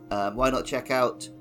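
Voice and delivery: usual speaking voice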